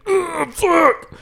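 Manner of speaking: sudden high strained yell; pained yell. Retold or imitated. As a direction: cry out from the shock. Retold pain when getting tased.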